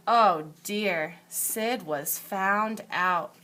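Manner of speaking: ordinary delivery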